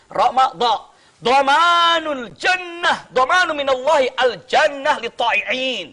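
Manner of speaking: loud, slow Arabic declamation; preaching at full force, slowed and loud. Acted. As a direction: proclaim it to the congregation.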